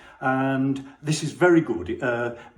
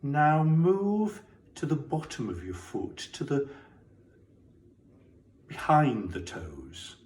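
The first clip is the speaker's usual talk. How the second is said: guided body scan; 6 dB quieter than usual. Acted. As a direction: guide them gently through the body, leaving space.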